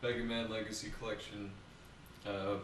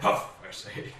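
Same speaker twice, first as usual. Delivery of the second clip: mock huffy exclamation; 9 semitones higher, 7 dB louder than usual. Quoting game character.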